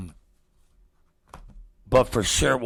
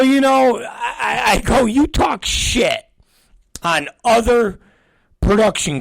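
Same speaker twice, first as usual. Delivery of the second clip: higher, louder ranting shout; retold shout; 11 semitones higher, 4 dB louder than usual. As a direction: blast him with it. Retold rant at producer.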